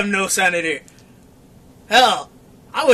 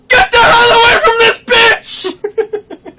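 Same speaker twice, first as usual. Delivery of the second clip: high, drawn-out alarm yell; mock warning shout; 12 semitones higher, 6 dB louder, much slower than usual. Warning bells about a clingy girlfriend, mock alarm shout. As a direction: scream it slowly like a warning siren. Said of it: "there should be warning bells of".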